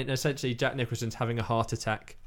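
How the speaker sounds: normal talking voice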